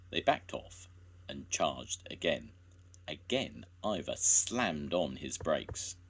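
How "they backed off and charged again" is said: usual speaking voice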